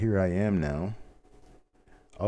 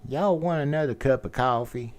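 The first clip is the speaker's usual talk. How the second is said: Mid-South accent; 7 semitones higher, 3 dB louder than usual. Shown as a demonstration. Showing his best Mid-South accent, a waitress offering coffee. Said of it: "accent would be Mid-South".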